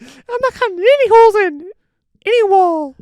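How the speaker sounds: very high, loud squeaky voice; nine-year-old action hero. Pretend. Host as the child star refusing, loud squeaky kid voice. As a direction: protest like a shrunken tough guy. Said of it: "Can you imagine?".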